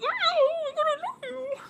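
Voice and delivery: very high squeaky gushing; starstruck fangirl. Retold or imitated. Meeting girl YouTubers she admires, very high flustered gush. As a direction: squeak it out like a fan losing her cool. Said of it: "I, like, clam up".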